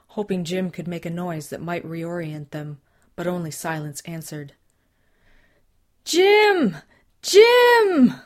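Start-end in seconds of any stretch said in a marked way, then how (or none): 6.0-8.1 very high, very loud desperate calls; calling out. Acted. Susan calling lost Jim in the dark bush, desperate shout. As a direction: shout his name into the dark woods. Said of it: "She called out".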